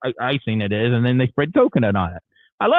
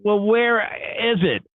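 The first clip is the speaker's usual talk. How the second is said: slow, higher-pitched imitation of the receptionist; pressing question; 7 semitones higher, slower than usual. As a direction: draw it out, pressing for an answer. Receptionist presses, asking a second time.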